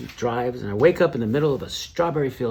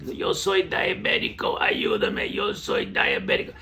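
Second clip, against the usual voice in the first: strained, slow Spanish plea, lurching in pitch; imitated ailing Mexican man; 9 semitones lower, slower than usual. Sick passenger begging for help in Spanish, imitated distress. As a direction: act out a passenger in medical trouble. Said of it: "He's saying, saying things in Spanish I didn't get".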